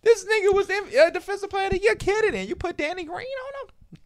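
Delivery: very high-pitched disbelief; high, exasperated incredulity; incredulous rant. Real, not acted. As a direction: question the coach's sanity in a squeaky-high voice. Incredulous rant about coach's decision.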